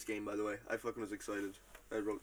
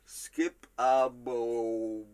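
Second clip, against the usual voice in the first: extremely slow, elongated syllables; recreated odd utterance; 4 dB louder, much slower than usual. Retold or imitated. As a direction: pull the sounds out as long as possible. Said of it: "how I have it written down is".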